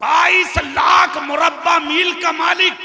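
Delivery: high, slow, ringing proclamation; impassioned preaching. Real, not acted. Preacher hailing Umar's vast rule to the crowd, impassioned. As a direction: proclaim it slowly at a shout.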